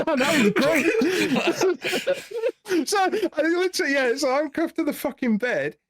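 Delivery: high-pitched giggling, slow start; laughing high. Real, not acted. Answering the host's quip, giggling high.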